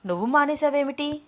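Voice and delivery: a mildly surprised man's question. A narrator's reading. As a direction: ask it in open surprise. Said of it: "ఆశ్చర్యంగా అడిగాడు అభిరామ్".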